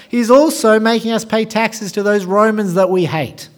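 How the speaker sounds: loud, high outraged complaint; indignant crowd. Acted. Townspeople objecting to Jesus eating with the tax collector, indignant. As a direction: rail against him as one of the cheated townsfolk. Acting the shocked, hostile crowd.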